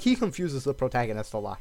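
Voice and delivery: normal talking voice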